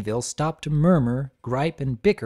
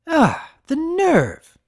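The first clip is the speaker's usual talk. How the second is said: high, loud, slow outraged gasp of a line; indignant exclamation; 6 dB louder, much slower than usual. A narrator's reading. Blossomforth overhears a lie, exclaiming high and outraged. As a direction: drag out the outrage. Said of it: "exclaimed a feminine voice".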